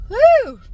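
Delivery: short, falling "whew" cried out high; high loud whoop. Real, not acted. Driver reacting mid-chat on the road, high whoop. Wordless high exclamation while driving.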